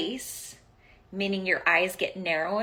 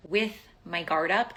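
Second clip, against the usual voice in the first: ordinary delivery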